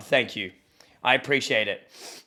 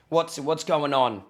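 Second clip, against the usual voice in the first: normal talking voice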